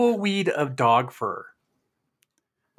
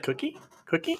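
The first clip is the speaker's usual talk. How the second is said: dog voice; 10 semitones higher, 7 dB quieter than usual. Retold or imitated. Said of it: "he's like".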